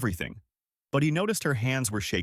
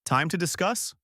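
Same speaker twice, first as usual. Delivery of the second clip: high, incredulous echo; mild bark; 7 semitones higher than usual. A narrator's reading. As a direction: throw it back as a challenge, pitched high. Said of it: "Zartok barked again".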